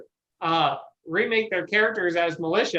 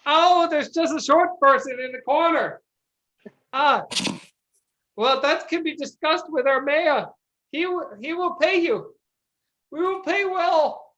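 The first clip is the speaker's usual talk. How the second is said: peasant voice; 6 semitones higher than usual. Acted. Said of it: "peasant man looks around, goes".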